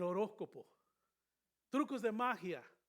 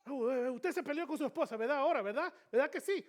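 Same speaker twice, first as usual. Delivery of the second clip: higher, louder, coaxing with a laugh; mock fortune teller; 7 semitones higher, 13 dB louder, faster than usual. Preacher mimicking a fortune teller to the church, hammed-up and coaxing. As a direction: ham up a nosy fortune teller fishing for a yes.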